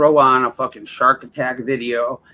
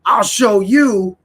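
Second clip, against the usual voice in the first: slow, pitched-up sulky taunt; petulant viewer voice; 6 semitones higher, 6 dB louder, slower than usual. Retold or imitated. Streamer mocking lurkers who return, slow petulant voice. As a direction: drag out a childish dare. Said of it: "It's, it's like".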